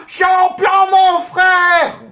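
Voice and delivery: high, loud joyful shout. Real, not acted. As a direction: call out to him at full volume. Shouting to player Lucas Moura.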